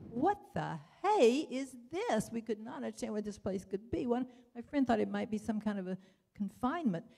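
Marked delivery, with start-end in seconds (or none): 0.1-2.3 puzzled surprise. Retold or imitated. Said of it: "and we thought".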